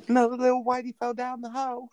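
high, fast Brenda voice; Brenda impression. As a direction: rattle it off like Brenda. Quoting Brenda from the movie.

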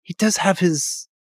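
high fake British voice, slowed; hammed-up fake British accent. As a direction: play the nervous British assistant. Doing British character's voice.

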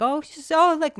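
high nervous voice; nervous, loud and high; high-pitched. Retold or imitated. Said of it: "my voice is in a different register".

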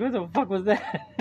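high-pitched mock indignation through laughter; mock pissed-off daughter. Dad imitating his startled daughter, mock pissed off. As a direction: voice an annoyed little girl, amused. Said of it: "She's pissed now. She's like".

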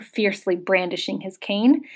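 plain reading voice

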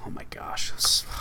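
breathless whisper. Real, not acted. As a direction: whisper it in awe. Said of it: "I'm, like, panting".

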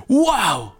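high, loud amazed wow. Pretend. Listener seeing the fruit, amazed high wow. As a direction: gasp it out in wonder. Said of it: "dirás".

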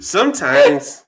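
put-on loud, drawn-out African-accented opener. Dad starts his lesson amid giggles, African accent. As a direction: begin grandly in an African accent. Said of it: "African accent".

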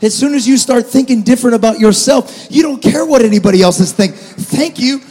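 loud, ringing sermon shout; preaching at full force. Acted. Pastor urging the church to stop caring what others think, shouting. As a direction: proclaim it like a declaration of freedom.

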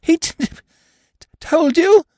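high, slow, stuttering in panic; a stuttering, panicked uncle in a high voice; stammering. Acted. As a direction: choke on the words in shock. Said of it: "he stammered".

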